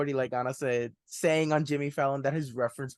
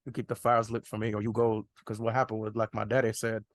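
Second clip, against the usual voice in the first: put-on [name] accent; 4 semitones lower than usual. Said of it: "every time he mentioned that phrase".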